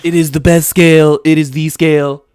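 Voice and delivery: hammed-up rally speech, boomed out; Donald Trump. Podcast host praising a tuba scale, as Trump at a rally. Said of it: "I kinda have a good Trump impression".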